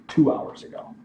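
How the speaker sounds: ordinary talk, nothing marked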